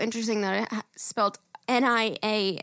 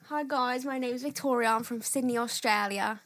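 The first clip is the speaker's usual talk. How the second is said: imitated Australian accent, slightly softer; 2 semitones higher, 4 dB quieter than usual. Co-host mimicking Victoria from Sydney, Australian accent. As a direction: copy an Australian woman introducing herself. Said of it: "I love Australian accents".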